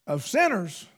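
normal talking voice

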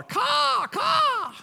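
high shouted crow caw. Retold or imitated. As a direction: holler like a crow on the power line. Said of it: "and he holler".

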